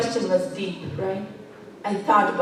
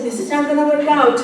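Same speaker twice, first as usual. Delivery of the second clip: exasperated; 7 semitones higher, 6 dB louder, faster than usual. Retold or imitated. Mother complaining to son.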